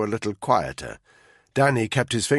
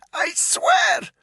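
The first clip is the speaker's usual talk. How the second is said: high, strained shouted plea; desperate plea; 3 dB louder than usual. A narrator's reading. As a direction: cry out in panic. Pleading under torture.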